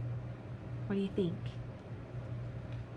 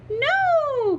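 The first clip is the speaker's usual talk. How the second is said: high, loud drawn-out refusal; alarmed cry; 7 dB louder than usual. Acted. Witch refusing the hungry dragon, high alarmed cry. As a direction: cry out in storybook panic. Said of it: "cried the witch".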